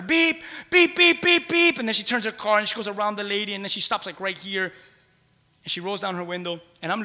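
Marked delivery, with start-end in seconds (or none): 0.0-1.8 high honking beep beep; imitated car horn. Pastor imitating the angry driver's horn, high and honking. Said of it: "she starts to beep at her".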